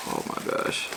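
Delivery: breathless whispered oh my gosh; awed whisper. Real, not acted. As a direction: whisper it in awe at the food. Excited about the food.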